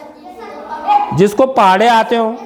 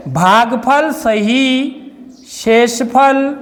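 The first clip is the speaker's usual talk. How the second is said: slow dictation; much slower than usual. Shown as a demonstration. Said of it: "teacher dictating formula parts".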